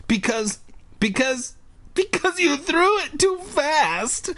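put-on very high, squeaky girl stammer; halting restarts, then a long high whine. A narrator's reading. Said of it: "Ashley stammered".

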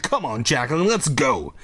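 ordinary delivery

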